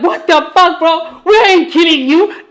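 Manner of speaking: a mild, laughing what-the-fuck; retold disbelief. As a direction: toss it off like a friend's joke.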